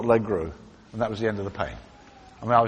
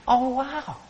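amazed gasp; 10 semitones higher, much slower than usual. Retold or imitated. Healed man amazed after prayer.

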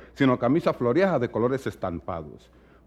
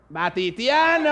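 slow, higher shouted jeer; mock shout; 8 semitones higher, slower than usual. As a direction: yell it out as a taunt. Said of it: "Y le gritó". (grito burlón, lento y agudo)

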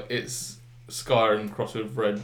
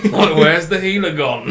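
higher, louder, laughing mock alarm; mock panicked shout; 7 semitones higher, 11 dB louder, slower than usual. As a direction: yell for the missing healer in jest. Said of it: "I don't wanna be like".

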